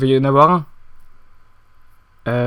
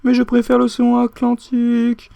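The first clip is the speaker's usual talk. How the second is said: slow absurd musing; 6 semitones higher than usual. Acted. Délire lent et absurde.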